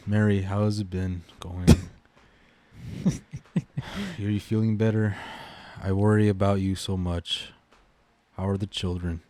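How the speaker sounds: slow, flat dramatic read; deadpan reading. Shown as a demonstration. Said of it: "I'll read it my way".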